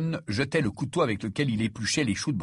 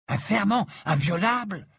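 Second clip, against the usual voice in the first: higher-pitched astonished question; stupefied exclamation; 8 semitones higher than usual. A narrator's reading. Ron reacts to news of an unbreakable vow, stupefied. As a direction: exclaim it in disbelief. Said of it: "s'exclama Ron, stupéfait".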